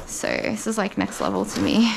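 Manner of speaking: usual speaking voice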